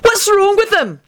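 high, slow wild question; wild, high-pitched alarm. A narrator's reading. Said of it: "Benji said wildly to Sirius".

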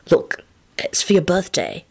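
hushed, hasty offering. A narrator's reading. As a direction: get it out before nerves win. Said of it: "he pronounced hastily".

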